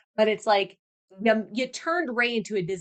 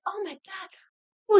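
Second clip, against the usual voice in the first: mock swooning; 10 semitones higher than usual. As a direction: coo it in mock romance. Mocks Kate being swoony in scene.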